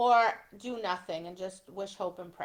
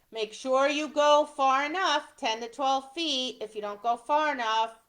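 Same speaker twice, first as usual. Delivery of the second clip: usual speaking voice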